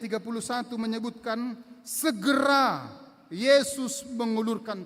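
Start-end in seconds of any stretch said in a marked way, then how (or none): 1.9-2.9 segera drawn out slowly and said louder; stress on segera. Shown as a demonstration. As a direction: lean hard on segera and stretch it. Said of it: "Kata segera diulang dua kali".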